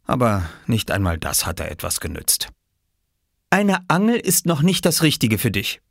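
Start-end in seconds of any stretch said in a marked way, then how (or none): 3.5-5.8 higher, gently firm mother; a mother turning down her son kindly; Mama's voice. Acted. Mama refusing her son a fishing rod, gently firm. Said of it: "hatte Mama gesagt".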